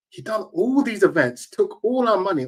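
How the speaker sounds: ordinary talk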